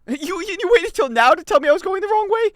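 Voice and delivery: very high, indignant squawk; incredulous complaint. Acted. Gamer told too late of the wrong way, incredulous. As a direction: protest in high-pitched disbelief.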